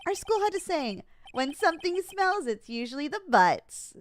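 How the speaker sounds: put-on high childlike voice. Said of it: "made him so high-pitched and small childlike".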